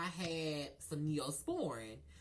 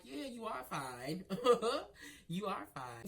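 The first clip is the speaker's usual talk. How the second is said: flirty laugh; 5 semitones higher, 4 dB louder than usual. Retold or imitated.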